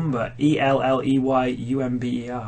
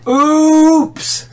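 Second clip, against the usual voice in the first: high squeaky voice; 14 semitones higher, 6 dB louder than usual. Acted. Character voice in silly story.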